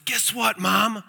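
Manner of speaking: excited call. Retold or imitated. Boy bursts in calling out.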